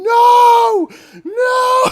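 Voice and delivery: high, loud retold yell of warning; imitating a frantic yell from the boat; mock shout. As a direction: shout the guy's warning from below. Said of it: "I see the guy going".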